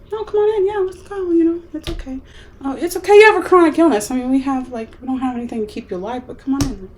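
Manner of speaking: mock their careless welcome; mocking casual welcome. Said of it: "they're just like".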